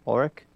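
"Ulrich" ending in a hard K, rising; questioning "Ulrich" with a K ending; alternative pronunciation. Shown as a demonstration. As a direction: say "Ulrich" as OR-rick, asking. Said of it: "Tomato, tomato".

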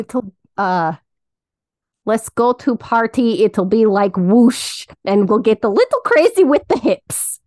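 playful cartoon bat with a Russian accent; Bartok. Quoting a cartoon bat she loved with her cousin, Russian accent. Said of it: "do the, the Bartok Russian accent and say his quotes".

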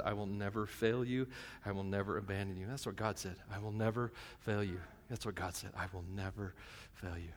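low, soft and repeated like a vow; solemn promise. Acted. God promising never to leave, low soft assurance. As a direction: bring it right down, quiet and sure.

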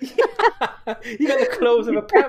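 slow, high, laughing repeat. Real, not acted. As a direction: laugh through the line.

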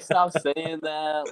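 mock protest. Driver retelling a protest to brother, pleading.

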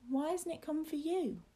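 put-on high-pitched childish question; challenging boy. Boy turns the question on his companion, high and challenging. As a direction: challenge him in a small high voice.

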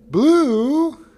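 high, loud sing-song call. Acted. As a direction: call the fish up from the pond. Man calls, worried, no answer.

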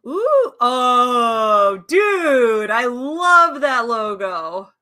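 gushing; excited. Real, not acted. Excited about logo.

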